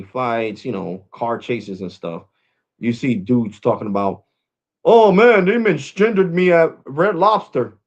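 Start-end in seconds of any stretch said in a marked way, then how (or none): 4.8-7.8 high, loud mocking whine; hammed-up high complaining voice; mocking whiny dude. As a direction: mimic a dude crying about being misgendered.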